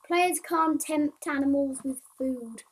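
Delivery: slow, loud bug-list read-out; reading aloud. Acted. Player reading bug numbers and fixes to viewers, reading aloud. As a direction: read each item out loudly, drawing it out.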